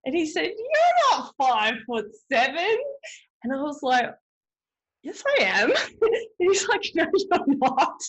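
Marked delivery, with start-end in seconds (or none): 0.4-2.8 slow, high mocking husband voice; husband teasing. Husband teasing wife about her height, slow mock disbelief. 5.0-5.7 high, louder mock insistence; insist, half offended; indignant protest. Wife insisting to husband she is five foot seven, mock indignant. Retold protest about her height. 6.9-8.0 high, loud mock husband denial; teasing denial. Husband contradicting wife, hammed-up teasing denial.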